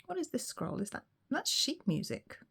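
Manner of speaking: ordinary delivery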